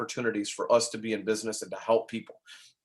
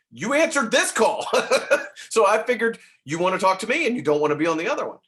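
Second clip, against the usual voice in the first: laughing; 8 semitones higher, 7 dB louder than usual. Real, not acted. Laughing about caller's choice.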